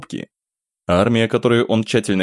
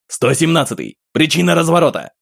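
calling loudly into the radio; raised voice over the airwaves; urgent radio call; 8 semitones higher, 3 dB louder than usual. Acted. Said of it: "голос молодого парня разнёсся по палатке". (громкий вызов по рации)

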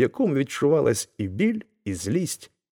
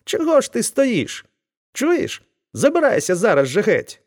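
furious blacksmith, much higher and louder; the blacksmith's yelling gets more impatient; angry shouting; 12 semitones higher, 4 dB louder than usual. A narrator's reading. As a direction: order him away at once. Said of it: "сердито закричав Вакула".

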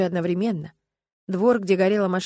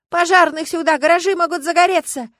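loud, high-pitched alarm; high, full-voiced cry of alarm; urgent shout; 10 semitones higher, 10 dB louder than usual. A narrator's reading. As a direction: shout for help across the yard. Crowd shouting after explosion.

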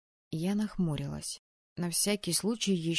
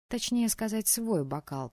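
usual speaking voice

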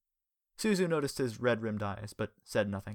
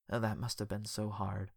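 soft, hushed sympathy; quietly; 6 dB quieter than usual. A narrator's reading. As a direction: say it gently, much softer. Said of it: "he said quietly".

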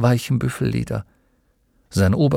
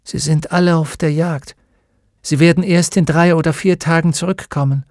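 a hint of a higher old woman's voice; lightly voiced old woman; 5 semitones higher, 3 dB louder than usual. A narrator's reading. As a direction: answer plainly, a little higher. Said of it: "Eine weißhaarige Frau ... antwortete".

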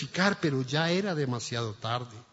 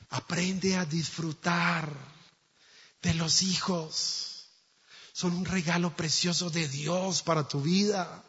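soft sincere preaching; 4 dB quieter than usual. Real, not acted. Sermon principle, slow soft.

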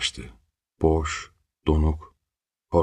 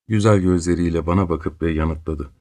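usual speaking voice

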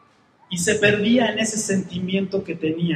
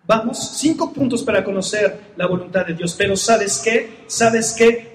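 ordinary delivery

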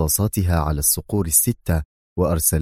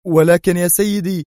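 much higher, louder alarmed protest; alarmed cry; 11 semitones higher, 4 dB louder than usual. A narrator's reading. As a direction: cry out in dismay. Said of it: "في جزع وهو يهتف".

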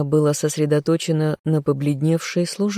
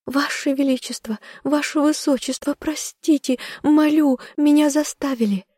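very high, panicked maid; frantic pleading; 11 semitones higher than usual. A narrator's reading. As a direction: beg for mercy desperately. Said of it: "дёргалась она".

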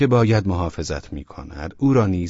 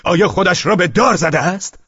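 louder, higher wild outburst; savage shout; 10 semitones higher, 5 dB louder than usual. Acted. Velchaninov shouting at the young man in alarm. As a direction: fling the question out fiercely. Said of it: "با وحشیگری فریاد کشید".

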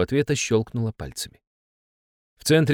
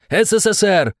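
much higher, loud frantic shout; roaring; 11 semitones higher, 5 dB louder than usual. Acted. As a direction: yell to make it stop. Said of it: "взревел я".

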